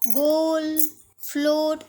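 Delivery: slow careful model words. Shown as a demonstration. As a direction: say each word clearly for the class. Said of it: "चलिए आगे है".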